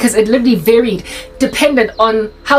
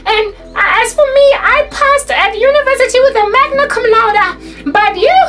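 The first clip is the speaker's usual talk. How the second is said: mock teacher scolding, pitched far above normal; tearful complaining teacher; 12 semitones higher than usual. Teacher lecturing naughty girls about results, mock-tearful high voice. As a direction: imitate a high-pitched, always-near-tears teacher. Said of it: "she sounded like a person that was like".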